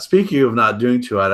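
usual speaking voice

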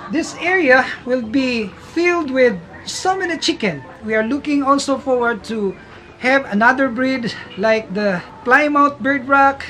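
normal talking voice

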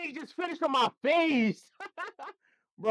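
ordinary talk

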